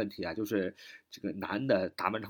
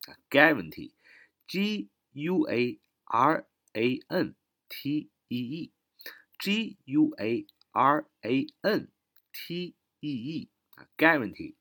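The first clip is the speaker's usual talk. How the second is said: slow spelling; 4 semitones higher, much slower than usual. Shown as a demonstration. Teacher spells word letter by letter.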